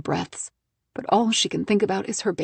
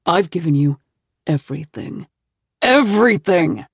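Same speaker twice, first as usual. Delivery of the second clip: loud, building to a yell; rage, peaking on the last word; angry husband shouting his grievance; furious; 6 dB louder than usual. Acted. Husband rages at wife.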